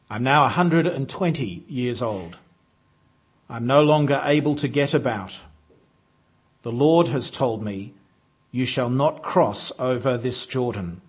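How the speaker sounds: ordinary talk, nothing marked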